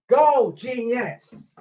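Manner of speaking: high, loud, long-drawn call; shouted cue. Shown as a demonstration. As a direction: yell the cue, stretching it out. Calling action in a demonstration.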